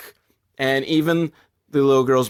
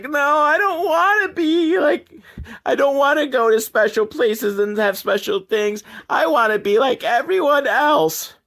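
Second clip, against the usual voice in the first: put-on whiny little girl; 10 semitones higher than usual. Little girl refusing special places, mock wailing. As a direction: plead in a put-on child's high voice. Retelling the girl's tearful protest.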